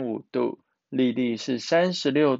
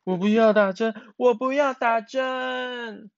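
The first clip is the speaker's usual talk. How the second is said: crying yell; 9 semitones higher, 4 dB louder than usual. A narrator's reading. Miaomiao refusing injection at the doctor's, yelling tearfully. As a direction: wail it like a child throwing a fit. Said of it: "并且大叫".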